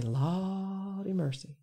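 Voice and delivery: slow, soft Southern drawl on Lawdy mercy. Retold or imitated. Quoting what folks in the South say, in a soft Southern drawl. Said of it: "that's big in the South. You know, we'll say".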